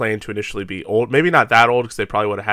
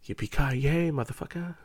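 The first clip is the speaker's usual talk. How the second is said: muttered catchphrase; 4 semitones higher, 9 dB quieter than usual. Retold or imitated. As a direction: throw it away quietly. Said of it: "just going".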